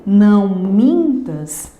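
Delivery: "Não mintas" slowly in two beats, MIN stretched; syllable counting. Shown as a demonstration. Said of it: "duas sílabas poéticas".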